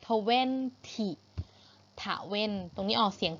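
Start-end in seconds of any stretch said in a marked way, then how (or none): 0.1-1.1 TWENTY drawn out syllable by syllable; demonstrated pronunciation. Shown as a demonstration. Teacher showing pupils how twenty sounds, demonstrated pronunciation. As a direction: say TWENTY slowly, ending in a short "tee". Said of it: "ยี่สิบ ออกเสียง twenty".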